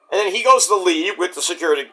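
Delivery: usual speaking voice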